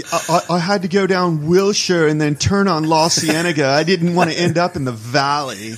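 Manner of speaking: hint of a California valley accent; lightly imitating a California accent. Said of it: "they do the California accent".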